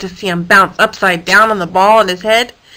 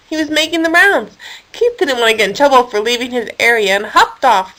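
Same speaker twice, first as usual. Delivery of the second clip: ordinary delivery, nothing marked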